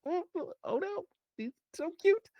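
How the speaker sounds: high-pitched gushing coo; cooing over cuteness. Acted. Cooing over cute red panda.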